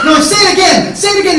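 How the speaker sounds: high, full-force shouted demand; frenzied high yell; enraged screaming. Retold or imitated. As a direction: shout it over and over, furious. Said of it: "And I'm screaming".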